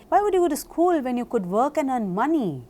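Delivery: rushed through with no pauses; speedy, no juncture. Shown as a demonstration. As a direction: run all the words together at speed. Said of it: "say it with speed".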